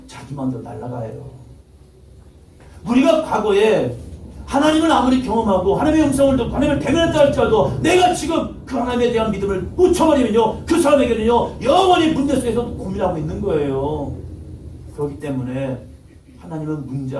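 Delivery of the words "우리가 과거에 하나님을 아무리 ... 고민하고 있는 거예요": high, loud, building sermon cry; loud preaching climax; full-force preaching. Real, not acted. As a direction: preach it out hard to the congregation.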